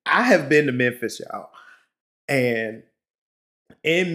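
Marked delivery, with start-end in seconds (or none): none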